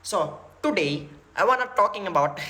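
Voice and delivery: Zakir Naik. Retold or imitated. Lecturer opening a talk, imitated Indian accent. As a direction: put on an Indian preacher's English accent. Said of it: "kalau kalian dengar Dokter Zakir Naik".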